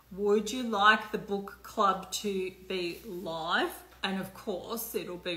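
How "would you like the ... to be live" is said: slow, drawn out, rising at the end; slow repeated question. Shown as a demonstration. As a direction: stretch the question out and lift the last word.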